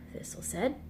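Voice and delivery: ordinary delivery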